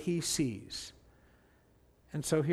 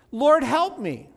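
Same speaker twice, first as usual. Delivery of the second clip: slow, high, louder appeal, read aloud; narrated plea, drawn out and raised; pleading; 8 semitones higher, 6 dB louder, slower than usual. A narrator's reading. Woman kneeling before Jesus asking for help, pleading.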